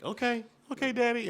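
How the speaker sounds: high, small voice of a son; little child's voice. Retold or imitated. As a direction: imitate a toddler agreeing with Dad. Voices his young son's reply.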